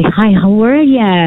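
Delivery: slow, sugary mock-friendly hello; fake friendly greeting. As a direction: sweetly greet someone you despise. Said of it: "I was ever so friendly".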